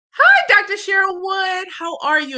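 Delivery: high-pitched, delighted hello to a guest; excited greeting. Real, not acted. Host welcomes an esteemed guest to her live stream, excited. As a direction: greet them in a high, thrilled voice.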